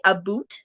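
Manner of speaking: "a boot" with an oo vowel; Canadian "aboot". Retold or imitated. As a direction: say "a boot" with oo in place of ow. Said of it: "Apparently, I did say".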